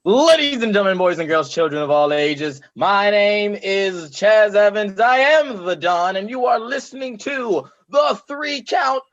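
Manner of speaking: booming ring announcer, building to a shout; ringmaster. Acted. Podcast host's announcer intro.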